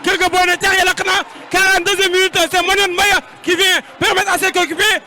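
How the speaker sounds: loud live match call; excited play-by-play. Real, not acted. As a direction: call the goal with energy. Live goal commentary.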